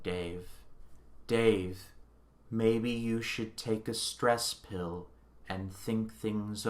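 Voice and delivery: slow, soft, unbothered AI voice; calm computer voice. Retold or imitated. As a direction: plead calmly like a cold computer. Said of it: "Hal is just there like".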